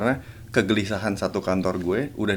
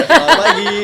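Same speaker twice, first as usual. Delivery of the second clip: high, loud mock greeting; chirpy notification greeting; 9 dB louder than usual. Income text message greets her, high playful announcing voice.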